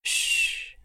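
long, soft hushing sound; soothing shush. A narrator's reading. Boyfriend soothes her stammered apology with a soft shush. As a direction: hush her gently. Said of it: "sagte er beruhigend".

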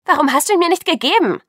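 put-on angry girl confronting friend. Bella angry at hung-up call.

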